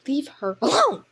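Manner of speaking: angry shout, building on the last word. Acted. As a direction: snap it loudly in defence of a friend. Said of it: "Speckled Cloud said angrily".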